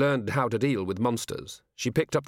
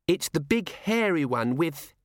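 put-on little girl voice; 6 semitones higher than usual. Child describing monster.

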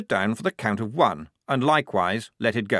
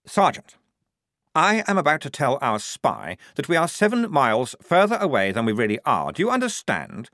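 higher, insistent, each question pressed harder; pointed scolding; 7 semitones higher, 4 dB louder than usual. Acted. Lieutenant rebuking the sergeant in front of the squad, insistent. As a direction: drive the point home again and again.

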